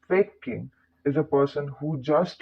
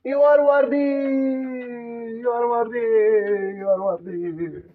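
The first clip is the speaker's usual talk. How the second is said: mocking a YouTube guru's chant; motivational YouTuber; 7 semitones higher than usual. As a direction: hype it then let it trail off. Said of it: "a YouTuber will say".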